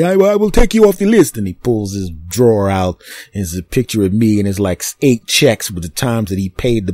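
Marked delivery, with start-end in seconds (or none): 0.0-1.4 high, pleading and loud; high scared voice. Retold or imitated. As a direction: whimper it like a cornered crook. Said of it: "quoting guy".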